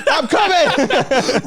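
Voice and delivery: hammed-up granddad hurrying to the rescue; frantic old granddad. Granddad voice again, laughing.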